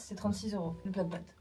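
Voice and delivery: ordinary delivery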